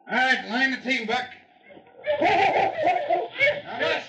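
calling out. Acted. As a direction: shout across the yard to the musher. Calling out to dog team.

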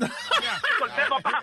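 lo dice muy lento entre risas; suéltalo riéndote; riendo. Acted. (riéndose de su propio chiste)

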